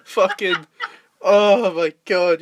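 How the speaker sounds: ordinary delivery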